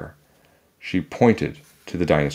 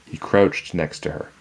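usual speaking voice

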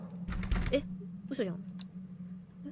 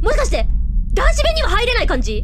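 higher and louder, gleeful teasing; excited loud taunting; 4 semitones higher, 7 dB louder than usual. Real, not acted. Streamer taunting the ghost from the men's toilet, loud gleeful teasing. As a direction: crow at the ghost who can't follow.

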